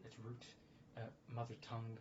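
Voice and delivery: normal talking voice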